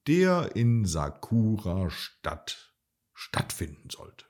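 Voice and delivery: ordinary delivery, nothing marked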